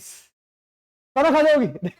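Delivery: playful drawn-out warning; teasing mock warning. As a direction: stretch it out to tease them.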